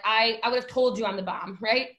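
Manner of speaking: ordinary delivery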